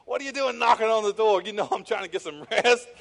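a half-laughing mock yell at the door; grumpy retold shout. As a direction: bark at him for knocking on the door. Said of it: "I bet he probably said".

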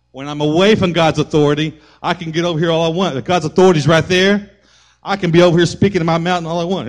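loud, high sermon build; full-force pulpit preaching; fiery preaching. Acted.

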